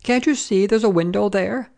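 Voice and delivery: high-pitched exasperated question; exasperated landlady's cry; crying out crossly. A narrator's reading. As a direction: scold an idiot in a high voice. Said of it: "she cried".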